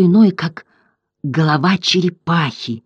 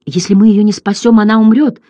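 louder, higher, pressing hard; insistent; 6 semitones higher, 5 dB louder than usual. A narrator's reading. Atreyu urging the old turtle, loud and insistent. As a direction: insist that she must be saved. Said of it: "настойчиво добавил Атрей".

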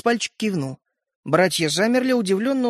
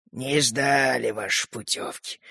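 grandfather's voice; 6 semitones lower, 5 dB quieter, slower than usual. A narrator's reading. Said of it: "голос деда".

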